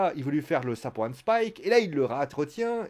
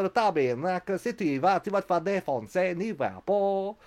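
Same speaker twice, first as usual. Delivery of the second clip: higher-pitched, exaggerated rotten Québécois accent; 5 semitones higher than usual. Worried wrestler warning about going out there, in a bad Québécois accent. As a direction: ham up a terrible Québécois accent. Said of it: "j'ai fait l'accent québécois le plus pourri".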